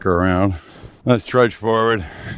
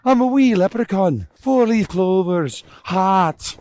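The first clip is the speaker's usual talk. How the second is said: mock Irish leprechaun; 9 semitones higher, slower than usual. Playing a leprechaun.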